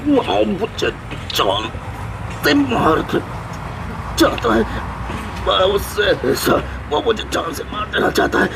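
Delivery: very high, strangled panic; choking while speaking. Acted. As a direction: force broken phrases out against a choke. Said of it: "आप अपना गला खुद दबा रहे हैं". (दबे गले से घुटी, ऊँची आवाज़)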